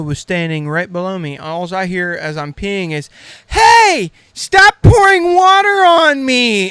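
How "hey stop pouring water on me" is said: high, loud, put-on girl's voice; a shrill-free squeal of a girl getting splashed from above; girl yelling up. Girl below the overhang yelling up at the friend, high mock shout. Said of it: "And then she looks up and goes".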